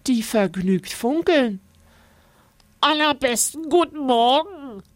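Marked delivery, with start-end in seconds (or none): 2.8-4.8 hohe, freundliche Fabelwesenstimme; begrüß ihn fröhlich als seltsames Wesen. A narrator's reading. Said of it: "sagt das seltsame Wesen freundlich". (hohe, freundliche Wesenstimme)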